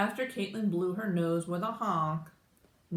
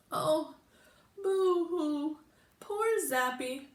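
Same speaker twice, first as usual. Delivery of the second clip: high, hammed-up boo-hoo sobs; sobbing; 10 semitones higher than usual. A narrator's reading. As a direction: blubber it out, way up high. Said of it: "sobbed Caitlin".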